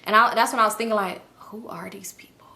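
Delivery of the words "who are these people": hushed, unvoiced whisper; whispering to herself, lost. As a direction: breathe it out in the dark, scared to be heard. Confused at night, hearing strangers.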